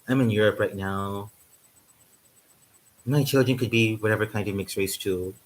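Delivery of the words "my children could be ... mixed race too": ordinary talk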